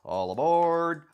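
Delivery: loud high all aboard call; train conductor. Pretend. Conductor call after train whistle.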